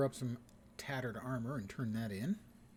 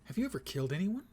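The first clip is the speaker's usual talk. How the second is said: quick, higher, louder quoted question; Jamie Lee Curtis; 7 semitones higher, 6 dB louder, faster than usual. Retold or imitated. Wife questions her husband in True Lies, lightly acted quote. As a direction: lightly act out an urgent movie question. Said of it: "when Jamie Lee Curtis asks".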